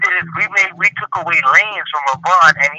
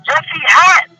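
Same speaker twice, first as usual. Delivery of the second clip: emphatic rebuttal; 12 semitones higher, slower than usual. Real, not acted. Rebutting the playoff claim.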